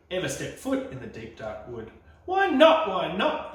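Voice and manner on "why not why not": put-on eager child. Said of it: "child asks eagerly".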